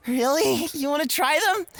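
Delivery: light up with excitement; delighted. Acted. Host thrilled the guest wants his drinks.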